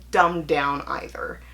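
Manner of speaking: ordinary delivery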